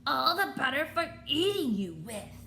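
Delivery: the wolf springs the trap; wolf reveal. Acted. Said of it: "same wobbly voice".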